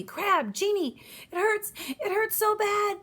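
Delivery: high, loud wailing in pain; bawling. Acted. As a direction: wail like a hurt boy. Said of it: "Ernie was bawling now".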